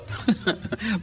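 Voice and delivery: a quick high chuckle; high laugh. Real, not acted. Laughing at own story.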